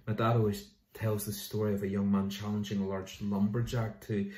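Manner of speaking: usual speaking voice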